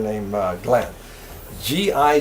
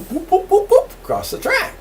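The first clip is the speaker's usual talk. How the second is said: boop boop, much higher and bouncing; bouncy boop sounds. Retold or imitated. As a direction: mimic the bear hopping with high boops. Imitating bear bounding across track.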